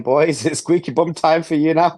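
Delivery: put-on cracking up while trying on a Scottish accent; laughing Scottish attempt. Pundit caves to requests and tries Sir Alex's Scottish accent, laughing. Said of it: "Please, please try".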